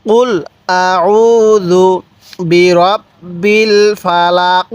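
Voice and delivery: drawn-out Quranic chanting; stretched, measured recitation; slow recitation. Shown as a demonstration. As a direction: recite it slowly with tajweed. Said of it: "তাহলে আমরা কিভাবে পড়ব?".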